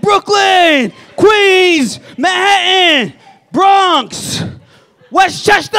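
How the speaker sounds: high, loud DJ roll call of boroughs; club hype man. Retold or imitated. Imitating the DJ hyping the crowd.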